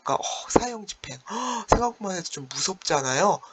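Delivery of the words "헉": gasp. Acted. Teacher gasps imagining the execution.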